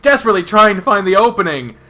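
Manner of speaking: high, loud frantic narration; desperate panic. A narrator's reading. Narrator claws wall for opening, desperate panic. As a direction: tell it at near-shriek urgency.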